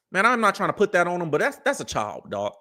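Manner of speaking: usual speaking voice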